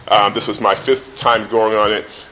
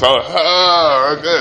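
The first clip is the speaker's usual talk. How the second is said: whining; 4 semitones higher than usual. Retold or imitated. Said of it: "became annoying".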